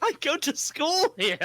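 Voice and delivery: high, slow, matter-of-fact reply; deadpan retort. Pretend. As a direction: say it like it's obvious. Voicing Jenny's flat reply.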